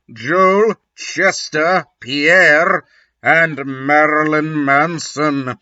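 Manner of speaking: usual speaking voice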